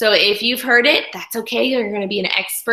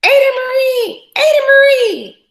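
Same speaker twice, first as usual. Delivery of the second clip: calling out; 7 dB louder than usual. Acted. Read-aloud calling the child.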